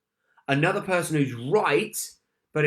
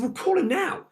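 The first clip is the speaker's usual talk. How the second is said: urgent. Retold or imitated. Urging her at midnight.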